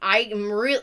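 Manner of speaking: normal talking voice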